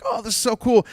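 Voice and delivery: high, loud mock gush; gushing enthusiasm. Someone starting a new friendship, gushing. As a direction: gush like it's brand new.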